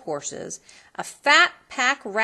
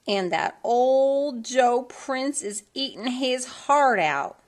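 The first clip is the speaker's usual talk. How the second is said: slow, higher, gloating; a hint of a catty sister; lightly voiced younger sister; 6 semitones higher, 4 dB louder, slower than usual. A narrator's reading. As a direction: savour the gossip.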